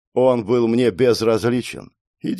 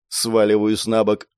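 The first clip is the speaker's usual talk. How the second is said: plain reading voice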